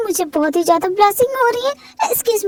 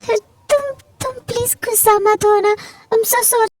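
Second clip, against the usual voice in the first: acted crying, pleading for forgiveness; tearful apology; 3 semitones higher than usual. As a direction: stammer the sorry out through sobs. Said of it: "तुम रो क्यों रही हो?". (रोते हुए, गिड़गिड़ाकर माफ़ी)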